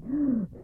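sharp gasp of alarm; startled gasp. A narrator's reading. Spirit hears the cock crow, sharp startled gasp. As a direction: catch the breath in fright. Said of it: "started with surprise".

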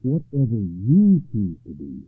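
loud, slow, deliberate vow; resolute, measured declaration; solemn knight. A narrator's reading. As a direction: pledge it slowly in a full voice. Knight solemnly yields the choice.

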